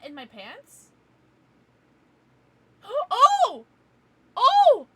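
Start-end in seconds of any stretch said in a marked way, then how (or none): 2.8-4.9 much higher, much louder "Oh"; very high loud gasping shout; shocked gasp. Real, not acted. Shocked reaction.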